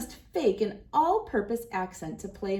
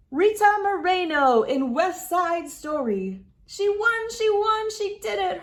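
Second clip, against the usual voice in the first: jubilant high shouts, much louder; fans cheering; 10 semitones higher, 6 dB louder than usual. A narrator's reading. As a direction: scream with joy as if the winner were family. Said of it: "her fans cry".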